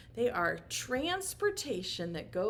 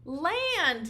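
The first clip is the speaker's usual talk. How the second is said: acting a kid's loud answer, higher; mock class answer called out; pretend child shout; 7 semitones higher, 6 dB louder than usual. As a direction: shout it back like an eager child. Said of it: "Say it really loud".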